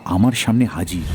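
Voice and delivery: ordinary delivery, nothing marked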